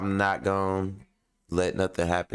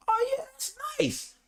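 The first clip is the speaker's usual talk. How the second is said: high mock admiring voice; high sing-song mock praise; mock admirer. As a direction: lampoon a fake compliment. Mocking people admiring his car.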